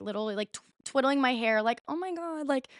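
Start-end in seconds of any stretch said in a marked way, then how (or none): 1.9-2.7 all innocent. Retold or imitated. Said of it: "I'm, like, all innocent".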